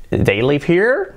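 slow "They live here" rising on "here"; wrong question tune. Shown as a demonstration. Teacher shows what not to say, questioning rise on "here". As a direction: turn the statement up at "here". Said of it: "en lugar de decir".

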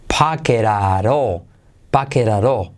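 "Paqueraró" slow, both Ts tapped as soft R; linked soft R. Shown as a demonstration. Teacher demonstrating for Spanish learners, "Paqueraró" linked with soft Rs. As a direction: run "pocket at all" together with Spanish taps. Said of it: "se convierte en R suave".

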